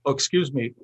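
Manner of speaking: ordinary delivery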